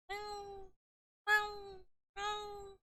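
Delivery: three tiny high kitten mews; kitten meows. A narrator's reading. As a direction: mew like a kitten three times. Kittens mewing again.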